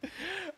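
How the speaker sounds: very high, soft trailing laugh; giddy laugh. Real, not acted. Host after flipping his pick, high giddy laugh. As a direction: squeak out a laugh.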